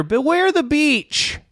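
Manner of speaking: high, slow sing-song warning; high, slow mock-ominous farewell. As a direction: warn them off in a high cackly tease. Parting warning after witch talk.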